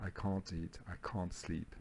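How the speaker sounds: soft British "can't" with a long back AH; British accent. Shown as a demonstration. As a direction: say it quietly the British way, "cahnt". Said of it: "Avec l'accent britannique". (accent britannique tout bas, « can't » avec un A long)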